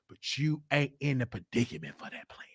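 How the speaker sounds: unvoiced confiding whisper. Real, not acted. Confiding to the viewers about mom's plans, whispered. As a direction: whisper it like a secret aside.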